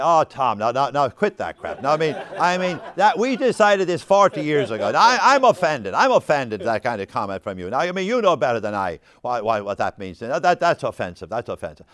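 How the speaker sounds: loud, indignant friend pushing back; loud mock outrage at a comment; offended protest. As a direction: play the offended friend, half laughing. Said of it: "And he immediately said".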